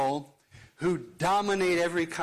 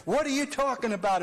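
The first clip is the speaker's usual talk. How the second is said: higher, quick heated retort; heated argument; 7 semitones higher, faster than usual. Retold or imitated. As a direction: fire it back mid-argument, pitched up and fast. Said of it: "we're all heated up".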